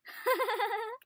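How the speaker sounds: high, mock villain cackle; evil laugh. Computer villain gloats over its plan, cackling.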